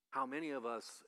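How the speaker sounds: ordinary delivery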